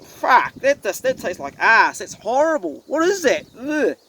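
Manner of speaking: high, pinched retold disgust at a bad chicken roll; disgusted reaction. A man retelling his first bite of a bad roll, disgusted high groan. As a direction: recoil from the taste, voice jumping up.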